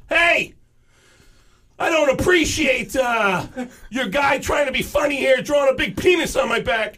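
loud high complaint on the phone; hammed-up furious phone call; high angry rant. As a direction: chew out the tattoo shop. Angry call to tattoo shop.

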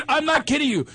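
normal talking voice

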